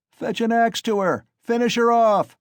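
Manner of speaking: very high, loud bloodthirsty yell; shouted. A narrator's reading. A third man in the crowd demanding an axe, very high loud yell. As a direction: scream for the kill. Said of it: "shouted a third".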